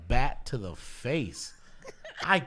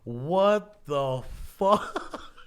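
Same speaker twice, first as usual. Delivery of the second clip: slow, stretched, louder disbelief with a laugh; stunned what the fuck; 3 semitones higher, 5 dB louder, much slower than usual. Retold or imitated. At the show watching the brawl erupt, stunned laughing disbelief. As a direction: drag it out like you can't believe your eyes. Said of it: "And I'm sitting there like".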